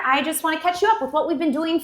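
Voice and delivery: normal talking voice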